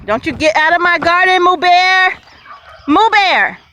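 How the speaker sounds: high, loud scolding call; calling the dog. Real, not acted. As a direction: shoo the dog out of the garden. Scolding dog Moo Bear in garden.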